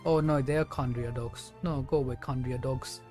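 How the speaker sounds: ordinary talk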